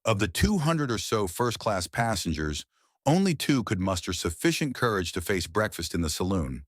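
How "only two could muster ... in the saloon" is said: plain reading voice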